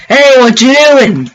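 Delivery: high, loud and slow, a creepy greeting; playful creeper sneaking up on you; sneaky creeper voice. Acted. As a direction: sidle up and greet them like a sneaking monster. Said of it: "coming in like".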